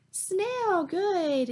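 high, bright naming; excited reveal. A narrator's reading. Telling the kids the answer, mildly excited. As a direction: announce the find with delight.

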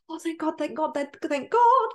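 acted queen saved at last; huge relief. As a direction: gasp out relief, climbing in pitch. Queen learns the name.

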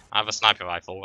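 normal talking voice

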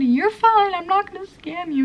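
high, loud singsong mimic; mocking scammer. Retelling the scam, she mocks the seller's promise in a high voice. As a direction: ham up the fake reassuring scammer. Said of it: "And the guy said".

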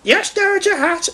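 very high-pitched obedient reply; high character voice; little engine Stanley. A narrator's reading. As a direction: answer as a tiny keen engine. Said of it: "said Stanley".

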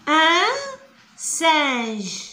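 "ان سانج" drawn out slowly, nasal vowel held; slow spelled-out French. Shown as a demonstration. Teacher to pupils, reading the word slowly. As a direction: stretch "سانج" as a careful model word. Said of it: "القرد".

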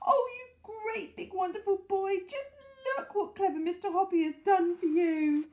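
high, adoring gush; high-pitched, overjoyed praise; delighted cry. A narrator's reading. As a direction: coo loudly over a beloved pet. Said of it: "she cried".

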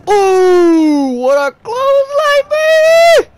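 high loud hyped shout; excited yell. Real, not acted. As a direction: hype up the big hit. Hyped reaction to big move.

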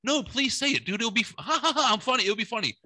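squeaky teasing begging; high mock pleading. Teasing the comic to tell his joke, high mock pleading with fake laughter.

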